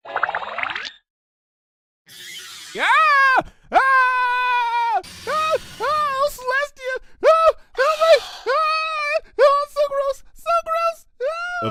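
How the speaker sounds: shrieking, very high, in full disgusted panic; high hysterical shrieks of gross-out terror; panicked screaming. Acted. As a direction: scream like something is crawling in your hair. Twilight panics over thing in mane.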